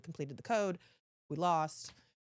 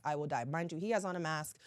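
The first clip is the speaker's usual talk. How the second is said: ordinary talk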